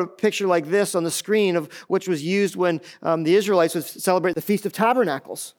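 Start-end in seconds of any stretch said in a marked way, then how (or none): none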